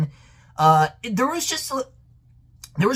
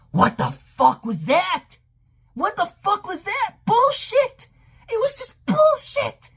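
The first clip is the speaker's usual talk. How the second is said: climbing to a high, incredulous squeal; outraged reaction; 13 semitones higher than usual. Retold or imitated. Viewer reliving his reaction to the film, outraged disbelief. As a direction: re-enact disbelief, pitch rising with each phrase.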